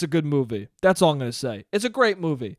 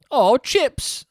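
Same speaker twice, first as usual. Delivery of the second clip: high, hammed-up British voice; mock British accent; 10 semitones higher, 6 dB louder than usual. [name] finds a chip bag, silly high British accent. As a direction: pipe it like a cartoon Brit. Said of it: "That's my [name] impression".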